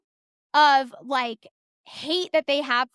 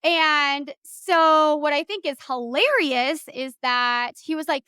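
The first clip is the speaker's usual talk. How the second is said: normal talking voice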